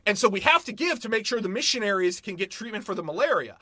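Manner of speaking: normal talking voice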